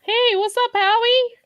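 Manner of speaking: high, loud happy hello; excited greeting. Real, not acted. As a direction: greet the dog with delight.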